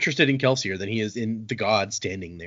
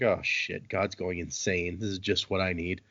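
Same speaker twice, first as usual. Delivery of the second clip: low, soft, dismayed grumble; muttered aside; 5 semitones lower, 8 dB quieter than usual. Acted. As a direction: mutter it to yourself, voice dropped. Kelsier's aside on mad god.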